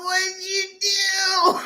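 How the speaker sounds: high, slow, stretched-out disbelief; shocked reaction. Retold or imitated. Reacting to her new chest, mock shock. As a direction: drag out the question up high, mock aghast. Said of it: "And I'm like".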